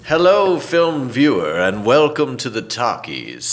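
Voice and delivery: slow, loud showman greeting; hammy announcer welcome; exaggerated cartoon intonation. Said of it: "Why are you talking like that?".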